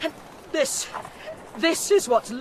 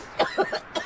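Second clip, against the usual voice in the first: high, breathy choking cough; gasping cough; 3 dB quieter than usual. Acted. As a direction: cough on bad air. Choking in smoke.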